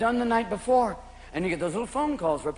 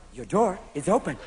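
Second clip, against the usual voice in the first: low, soft car-alert voice; talking car; 4 semitones lower, 5 dB quieter than usual. Acted. Japanese car to stoned driver, soft talking-car voice. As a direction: announce it softly like a car's recorded alert. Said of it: "new Japanese cars where you open the door and it goes".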